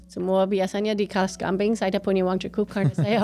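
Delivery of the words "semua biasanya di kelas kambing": ordinary delivery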